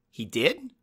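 high, alarmed question; worried surprise. A narrator's reading. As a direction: caught off guard.

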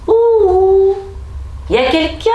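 high, loud call into an empty castle. A narrator's reading. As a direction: call out to see if anyone is home. Man calling out in empty castle.

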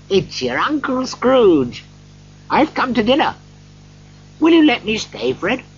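put-on high-pitched, eager old uncle; hopeful Scrooge. A narrator's reading. Scrooge asks nephew to let him stay, hopeful.

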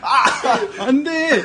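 high laughing protest. Real, not acted. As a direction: squeal with laughter. Laughing fit during prank.

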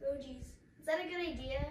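ordinary delivery

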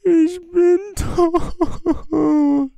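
high, very slow hammed-up wail; mock wailing. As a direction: wail it out dramatically. Character just died.

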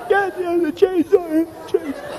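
high, loud, panicky insistence; frantic wife. Retold or imitated. Wife insisting to husband, high, loud and frantic.

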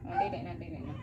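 unvoiced whisper of protest. Real, not acted. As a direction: whisper no, no gently. Pet resisting harness.

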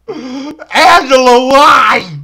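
drawn-out wail of Angela's name; hammed-up sobbing plea; mock anguished cry. Crying out to Angela.

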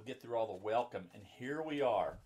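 ordinary delivery, nothing marked

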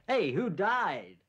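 high, loud drawn-out yell; top-of-the-voice shout. Retold or imitated. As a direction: call it out to the whole room, stretched long. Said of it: "At the top of his voice, he yells out".

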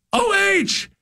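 high, drawn-out mock holler; imitated fan's yell. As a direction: imitate a stranger yelling it across the place. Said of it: "he'll yell".

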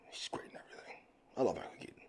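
soft whispered fan confession; whispered aside. Real, not acted. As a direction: breathe it like a secret. Fan aside about Keaton.

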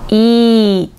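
"イー" stretched out as a long ee; drawn-out "イー"; long E vowel. Shown as a demonstration. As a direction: hold "イー" steadily. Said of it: "長く伸ばす母音".

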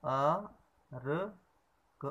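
each sound said apart, slowly: अ, र, क; syllable by syllable. Shown as a demonstration. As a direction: break अर्क into अ, र, क. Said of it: "हमने पढ़ा".

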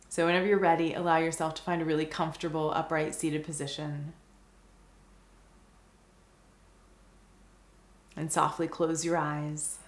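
calm meditation guide, long pauses between phrases; guided meditation. Acted. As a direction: guide the listener gently into stillness, unhurried and even.

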